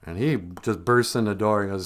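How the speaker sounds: normal talking voice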